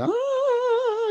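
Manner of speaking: imitating a rock singer's very high scream; mock high wail. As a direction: ham up a show-off's high screech. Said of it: "doing the".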